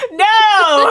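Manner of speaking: loud, high laughing protest; laughing outcry. Real, not acted. Co-host jokes about the strangling, a loud laughing no. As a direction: shout it out between laughs.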